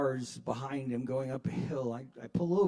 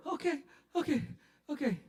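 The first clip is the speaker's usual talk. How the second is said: higher, quick frightened okays; scared okay; 11 semitones higher, faster than usual. Retold or imitated. Threatened driver giving in, high frightened okays. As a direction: give in fast and scared. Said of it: "say it like Grover".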